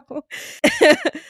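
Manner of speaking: a high, bright burst of laughter; high giggle. Real, not acted. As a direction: laugh at your own bit. Laughs after mock voice.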